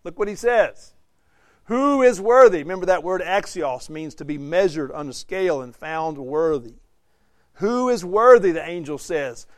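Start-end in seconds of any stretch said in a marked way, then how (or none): 1.6-2.6 high, booming call to the universe; loud angelic proclamation. Acted. Preacher voices an angel challenging all creation, loud proclamation. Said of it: "speaking with a loud voice". 7.5-8.5 raised, high-pitched angelic challenge; loud angelic proclamation. Acted. Preacher quotes the angel to the congregation, loud proclamation. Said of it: "speaking with a loud voice".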